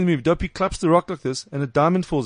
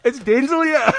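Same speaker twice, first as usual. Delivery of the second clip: high put-on voice; hammed-up high self-introduction; mock voice; 10 semitones higher, slower than usual. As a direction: announce yourself as a silly caller. Mock voice continues.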